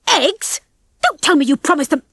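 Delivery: high-pitched, outraged outburst; exploding. A narrator's reading. Ginger learns Rocky promised the rats eggs, exploding. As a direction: burst out in disbelief. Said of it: "Ginger exploded".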